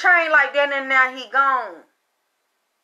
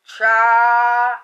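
normal talking voice

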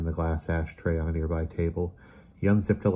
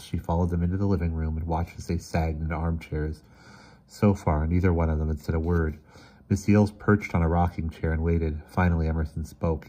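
usual speaking voice

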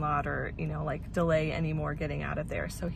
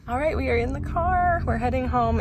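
excited; 6 semitones higher, 7 dB louder than usual. Real, not acted. Cheerful vlog update starting the drive.

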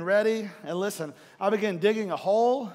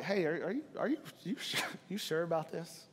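gentle questioning; 10 dB quieter than usual. Retold or imitated.

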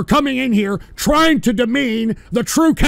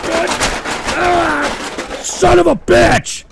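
high, sputtering enraged cursing; furious outburst; 6 semitones higher, slower than usual. Real, not acted. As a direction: lose it completely. Trolls play clip again.

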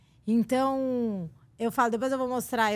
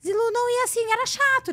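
much higher, animated complaint; excited protest; 10 semitones higher than usual. Real, not acted. Woman recalling boyfriend's visit, high excited complaint. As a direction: burst out with emphatic annoyance.

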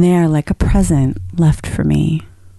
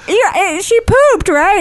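incredulous; 12 semitones higher, slower than usual. Retold or imitated. Retold stunned self.

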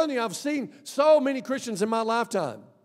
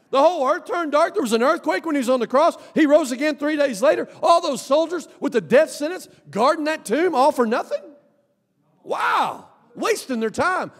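high, loud fervent preaching; full-force preaching; 8 semitones higher, 4 dB louder than usual. Real, not acted. Preacher building to a climax before the church.

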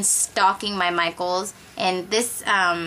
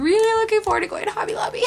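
gushing excitement; 12 semitones higher, 3 dB louder, much faster than usual. Real, not acted. Gushing over a craft haul.

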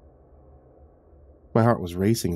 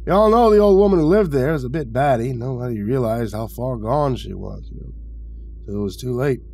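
higher, gossipy character voice, rising and dropping; chatty old retiree; 4 semitones higher, 4 dB louder than usual. A narrator's reading. Retiree tells visitor of the old woman, chatty. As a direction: recount the tragedy like a rambling neighbour.

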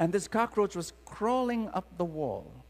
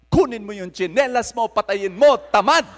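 much louder, impatient order in Filipino; blunt scolding command; 4 semitones higher, 13 dB louder than usual. Retold or imitated. God scolding a lazy eighteen-year-old in Filipino, much louder. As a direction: bark it like a fed-up parent. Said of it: "God said".

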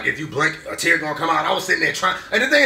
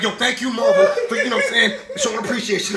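hyped thanks; 6 semitones higher, 3 dB louder than usual. Retold or imitated.

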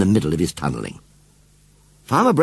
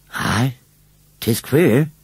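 plain reading voice

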